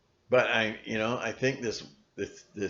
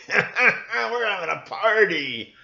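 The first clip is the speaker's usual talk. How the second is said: high, slow, laughing villain reply; gleeful mock villain; 10 semitones higher, 7 dB louder, slower than usual. Captor teasing the worried parent, slow giggling glee. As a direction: savor it with a creepy giggle.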